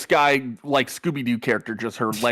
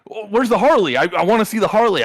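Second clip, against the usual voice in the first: high, eager and quick; eager excitement; 7 semitones higher, 4 dB louder than usual. Retold or imitated. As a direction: gush like a thrilled biker. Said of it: "he was just like".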